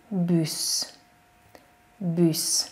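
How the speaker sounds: Bus with a rounded ü vowel; French bus. Shown as a demonstration. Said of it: "mindig űnek ejtjük".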